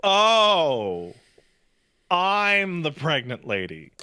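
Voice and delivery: wife realizing she's the pregnant one, mock surprise; sudden realization. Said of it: "and then goes".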